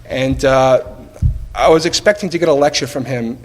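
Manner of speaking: ordinary talk, nothing marked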